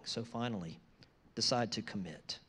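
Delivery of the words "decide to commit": usual speaking voice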